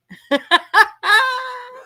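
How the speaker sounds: high, loud peal of laughter; real laughter. Real, not acted. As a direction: crack up helplessly. Cracking up over the blender story.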